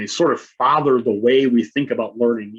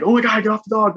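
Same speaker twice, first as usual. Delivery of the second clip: higher, startled mock cry; alarmed parents; 9 semitones higher than usual. Parents see the daughter ride their dog, alarmed higher cry. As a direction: yelp it like you just saw her climb on the dog. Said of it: "my wife and I are like".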